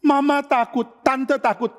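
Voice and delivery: high-pitched mock alarm; frightened. Mother telling the visitors her child fled upstairs, acted fright.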